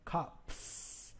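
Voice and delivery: "cops" whispered, ending in a hissed s; "cops" with a crisp voiceless s. Shown as a demonstration. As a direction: breathe out "cops" with an unvoiced s. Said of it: "you repronounce the plural caps".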